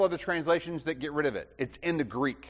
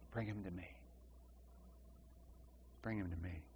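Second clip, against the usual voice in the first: much softer and lower, gentle and unhurried; tender invitation; 9 semitones lower, 23 dB quieter, faster than usual. Retold or imitated. Christ calling the boy to him, tender and quiet. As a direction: say it like an open-armed welcome.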